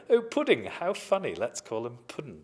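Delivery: posh schoolmates mocking the new boy, high and loud. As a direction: tease him like a posh bully.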